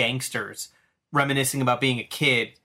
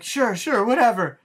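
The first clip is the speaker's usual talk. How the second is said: high, hurried nervous yes; nervous agreeing; 9 semitones higher, 3 dB louder than usual. Retold or imitated. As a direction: agree fast just to escape, pitched up. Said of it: "he's like".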